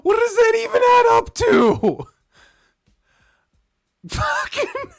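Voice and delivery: high loud disbelieving shout; exasperated shout. Real, not acted. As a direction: yell it in laughing disbelief. Exasperated over donation math.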